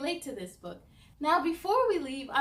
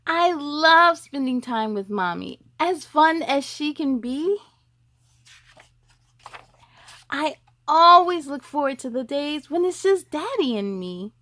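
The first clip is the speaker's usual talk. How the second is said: lively picture-book read, louder; reading to children; 11 dB louder than usual. Acted. Host reads a boy's story to young viewers, louder storybook voice. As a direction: read it out to the little ones, bright and full.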